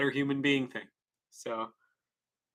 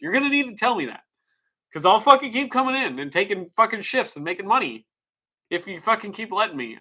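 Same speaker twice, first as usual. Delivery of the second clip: urgent pleading; 7 semitones higher, 3 dB louder than usual. Retold or imitated. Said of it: "And I'm like".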